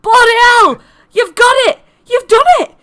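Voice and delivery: high, very loud amazed yelling; astonished shout. Acted. Winston spotting Flopsy crowned, astonished shouting. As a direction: shout it out in utter disbelief.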